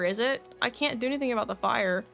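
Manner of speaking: usual speaking voice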